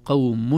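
قوم with a clear qawmun, the nun sounded; nun pronounced clearly. Shown as a demonstration. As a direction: make the nun of قوم heard. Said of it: "النون ظهرت".

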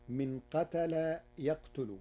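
ordinary talk

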